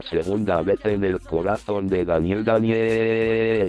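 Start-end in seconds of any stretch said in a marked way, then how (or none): 2.4-3.7 a mild read-aloud cry of the name; understated narrated shout of grief. A narrator's reading. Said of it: "gritan todos".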